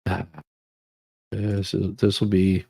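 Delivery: ordinary talk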